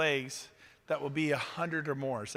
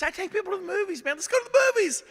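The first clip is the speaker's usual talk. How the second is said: much higher, bursting with enthusiasm; hyped invite to the movies; excited; 6 dB louder, much faster than usual. Real, not acted. Enthusing about fun outings.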